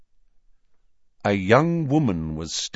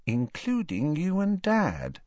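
sly; 6 semitones higher, 4 dB quieter than usual. Acted. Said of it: "said Ginny slyly".

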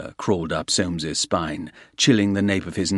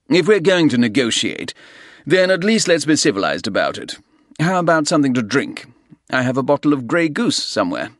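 fast, higher and louder eager host; hurried false cordiality; 7 semitones higher, 5 dB louder, faster than usual. Acted. Soames hastily offering the Russian a drink, fast. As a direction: rush the offer to smooth it over.

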